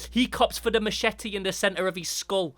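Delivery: ordinary delivery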